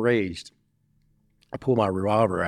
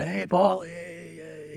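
excited, much higher, giddy hey; high guy greeting; 8 semitones higher, 4 dB quieter, slower than usual. Retold or imitated. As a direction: play a wired, coked-up friend. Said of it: "He's fucking high".

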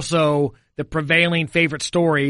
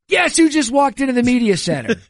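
high, louder excited read-out; excited all-caps text; 7 semitones higher, 3 dB louder than usual. Retold or imitated. Reading the all-caps text to his co-host, excited. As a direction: read the text like it's shouting at you. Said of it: "it's in all caps and seventy-five exclamation points".

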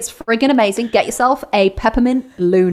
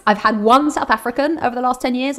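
normal talking voice

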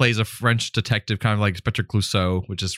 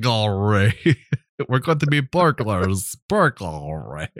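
put-on Russian accent; slower than usual. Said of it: "he's trying to say it in a Russian accent".

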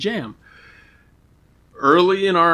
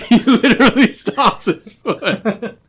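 laughing; 9 semitones higher, slower than usual. Real, not acted. Mocking friend, laughing hard.

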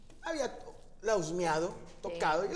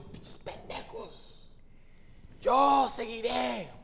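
defiant shout; 7 semitones higher, 3 dB louder, much slower than usual. Retold or imitated. Retold inner shout at friends.

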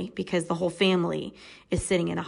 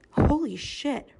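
stunned; 8 semitones higher, slower than usual. Real, not acted. Reacting to the story.

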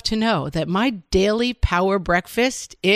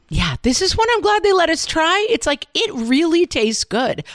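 excited ad read; 9 semitones higher, 3 dB louder than usual. Acted. Ad read enthusiasm.

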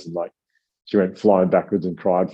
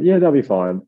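bright, louder, higher, overly casual; breezy fake reassurance; 6 semitones higher, 5 dB louder than usual. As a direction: sell it like everything's fine when it isn't. Feigning calm to the other parents.

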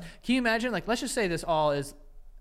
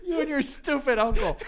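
high-pitched, giggly echo of an insult; cracking up while imitating; laughing imitation; 8 semitones higher, 3 dB louder than usual. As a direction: repeat the mock tirade through laughter. Laughingly repeats the acted insult.

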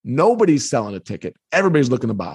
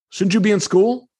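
ordinary delivery

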